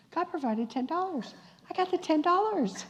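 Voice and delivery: high, rising joyful voice; acted-out glad excitement; retold delight. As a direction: build to a high-pitched happy exclamation. Waitress rejoices over the money.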